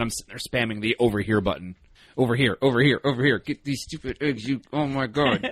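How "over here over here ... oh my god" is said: frantic calling. Acted. Acts out spamming button at teammates.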